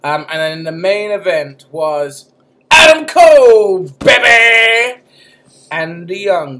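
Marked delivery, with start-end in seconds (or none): 2.7-5.0 much louder, higher, drawn-out wrestler's yell; shouted catchphrase. Retold or imitated. Podcast host naming the main event, shouting the wrestler's catchphrase. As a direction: belt it out like a ring catchphrase.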